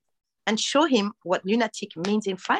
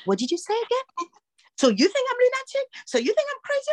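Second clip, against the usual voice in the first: much higher, rising mock outrage; offended husband. Husband offended by being called lunatic, high mock outrage. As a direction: play the offended husband, pitch climbing. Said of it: "And he was like".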